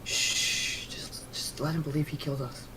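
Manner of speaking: hushed conspiratorial whisper. Real, not acted. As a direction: whisper it so he can't hear. Conspiratorial aside to other player.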